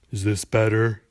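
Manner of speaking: loud, put-on deep voice; abnormally deep voice. A narrator's reading. Clerk spitefully answering a rude customer, abnormally deep voice. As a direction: force the voice deep in the throat. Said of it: "drop to an abnormally deep level".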